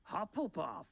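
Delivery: high-pitched theatrical announcement; high cry falling away at the end; hat's shout. A narrator's reading. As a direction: call it out high for everyone. Hat announcing a house aloud.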